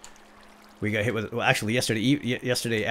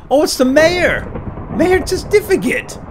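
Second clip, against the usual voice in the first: gasping, high loud exclamation; amazed surprise; 14 semitones higher, 6 dB louder than usual. Pretend. Gamer spotting the mayor unexpectedly, high startled shout. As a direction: cry out at an unexpected sighting.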